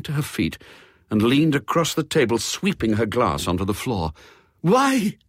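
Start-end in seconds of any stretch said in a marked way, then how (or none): 4.6-5.2 a sharp, high, loud cry of "Why?"; a distraught countess crying out; cried out. A narrator's reading. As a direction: cry it out, much higher and louder. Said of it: "she cried".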